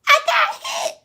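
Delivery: mock the intruder's squeal while laughing; imitating the shot intruder. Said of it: "she was like".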